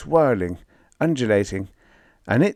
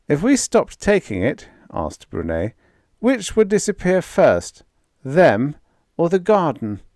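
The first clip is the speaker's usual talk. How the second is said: higher, pointed question; Brunet questioning; 8 semitones higher, 4 dB louder than usual. Acted. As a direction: press the question home.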